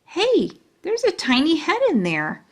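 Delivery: high, thrilled exclaim; excited. Acted. Junie B spotting the tiny picture, excited. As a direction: chirp it with delight. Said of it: "very excited".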